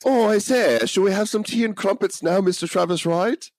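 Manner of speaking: high, hammed-up British toff accent; bad British accent. Host needling a reviewer who hates accents, mock British. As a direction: put on a silly high-pitched British accent. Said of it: "really bad French or British accents".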